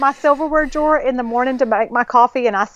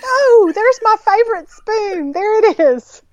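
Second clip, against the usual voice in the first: delighted; 10 semitones higher, slower than usual. Retold or imitated. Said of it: "Then I'm like".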